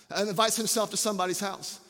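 ordinary delivery

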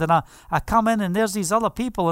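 ordinary talk, nothing marked

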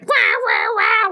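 high mock angry rah; hammed-up high road rage; mock honking driver. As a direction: squawk like an annoyed driver. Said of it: "somebody's like".

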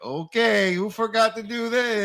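lightly imitating a teacher's baby talk. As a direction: talk down sweetly, like to toddlers. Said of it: "best baby voice".

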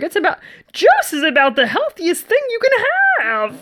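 high squeaky sitcom voice; high squeaky TV-character impression; squeaky voice guy. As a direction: pitch it up into a squeak like the Superstore guy. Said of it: "squeaky voice guy from Superstore".

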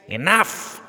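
high shrill shout. Acted. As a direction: cut the room off. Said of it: "the king shouted".

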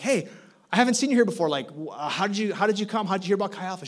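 high, eager welcoming questions. Retold or imitated. Pastor quizzes a new student, high and eager.

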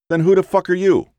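high, heated aggressive challenge; rising street anger at a stranger; indignant thug. A narrator's reading. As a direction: get in his face over the phone. Thug demands name.

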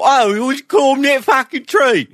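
high, hammed-up Cockney chimney sweep; chimney-sweep British accent. Shown as a demonstration. Co-host demos his favourite British accent, as high Cockney chimney sweep. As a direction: squawk it high as a cartoon Cockney. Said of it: "I'm a chimney sweep in the woods".

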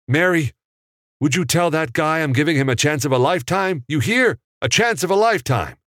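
a brash businessman selling his big deal; calling out lines. A narrator's reading. [name] calls Sam's lines from off camera, loud and high. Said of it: "continued with his off-screen lines".

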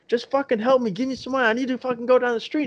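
high-pitched, urgent retold pleading; mock frantic demand. Retold woman frantic while hitting him.

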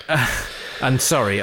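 ordinary delivery

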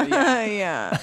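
slow yeah, laughing it out; Minnie's laughing yeah. Retold or imitated. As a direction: mimic a friend's lazy laughing yeah. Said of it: "That's something Minnie does".

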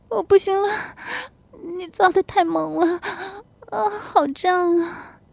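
high, gasping wife's voice; high-pitched, near-crying plea; overwhelmed moaning. Acted. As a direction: moan the words between breaths. Wife overwhelmed during lovemaking.

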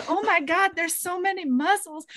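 mock swooning. Retelling heroine gawking at blacksmith.

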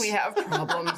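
high, slow line through laughter; laughing. Acted. Player telling friends at the bar of trouble, laughing. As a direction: crack up while announcing trouble.

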